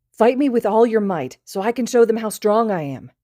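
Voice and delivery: high, loud bravado; tipsy challenge. Acted. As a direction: show off for invisible watchers. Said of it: "Midoriya initiates".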